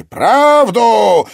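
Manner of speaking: high, drawn, booming command; furious villain's shout; roared demand. A narrator's reading. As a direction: demand it at full volume. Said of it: "потребовал голос".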